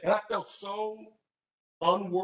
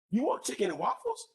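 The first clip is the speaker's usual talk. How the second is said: higher, rising disbelief; retold double-take, pitched up into a question; incredulous question; 7 semitones higher than usual. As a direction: echo the request in mock disbelief. Retells own surprise at the request.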